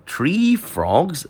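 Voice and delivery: high, disbelieving "Three frogs?"; can't believe what he just heard; a shocked echo of the manager's words; incredulous. Retold or imitated. Artist hears only three caught.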